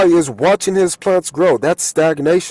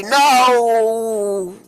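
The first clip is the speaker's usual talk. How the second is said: high, slow whiny plea for five more minutes; groggy sleeper; 8 semitones higher, much slower than usual. Acted. Sleeper begging whoever woke them for more time, whiny. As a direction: beg to stay in bed. Acted quote of sleeper refusing.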